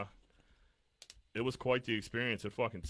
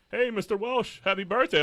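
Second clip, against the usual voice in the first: cheery pharmacy caller; 13 semitones higher, 9 dB louder than usual. Retold or imitated. Pharmacy caller wishing happy birthday.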